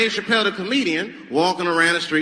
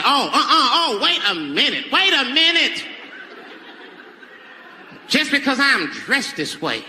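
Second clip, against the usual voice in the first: indignant woman's voice, higher, slowing to the end; offended woman; 7 semitones higher, slower than usual. Retold or imitated. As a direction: lecture him like a woman who's been disrespected.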